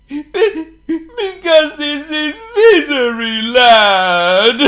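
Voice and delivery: high, loud, drawn-out weeping; theatrical crying, very high; weeping king. A narrator's reading. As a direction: wail it slowly through sobs. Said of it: "wept the king".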